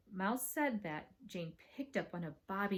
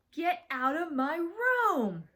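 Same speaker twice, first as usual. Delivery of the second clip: loud, high, drawn-out command; angry order; 9 semitones higher, 6 dB louder, much slower than usual. Acted. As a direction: order her out, stretching each word. Host orders sister out angrily.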